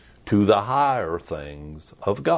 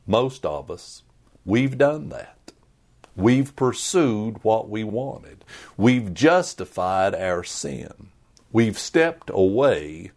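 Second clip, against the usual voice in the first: ordinary delivery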